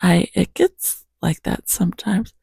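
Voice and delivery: real hoarseness, voice cracking into breath; hoarse morning voice. Real, not acted. Apologizing for morning voice before coffee, hoarse. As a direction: apologize through a hoarse throat. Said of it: "my voice is really hoarse".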